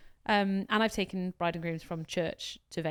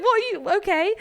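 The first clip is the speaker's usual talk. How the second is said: surprised; 10 semitones higher, 8 dB louder than usual. Retold or imitated. Retelling a reply to a drunk guest.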